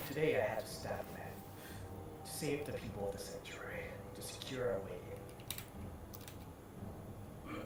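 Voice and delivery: put-on soft, hushed old priest counsel; a lightly voiced kindly priest; gentle priest.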